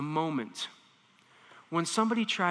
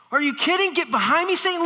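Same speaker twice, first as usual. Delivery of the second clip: loud, much higher sharp rebuke; intense rebuke; 10 semitones higher, 6 dB louder, faster than usual. Retold or imitated. Jesus rebuking Peter, loud and fierce. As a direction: rebuke him with full force. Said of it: "delivered with the same intensity".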